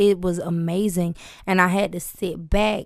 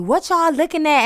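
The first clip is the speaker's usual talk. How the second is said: higher, louder what-are-you-looking-at; defensive snap; 9 semitones higher, 6 dB louder than usual. Retold or imitated. Singer confronting strangers who stare at her, defensive. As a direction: challenge the starers. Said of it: "I be like".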